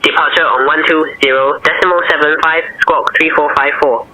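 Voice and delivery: normal talking voice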